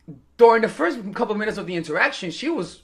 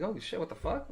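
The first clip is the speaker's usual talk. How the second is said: muttered to himself; 13 dB quieter than usual. Retold or imitated. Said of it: "I'm like".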